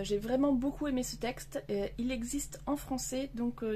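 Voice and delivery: ordinary delivery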